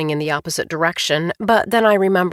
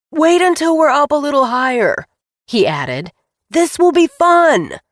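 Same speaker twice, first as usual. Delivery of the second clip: shouting; 7 semitones higher, 6 dB louder than usual. A narrator's reading. Pilot shouting over the engine.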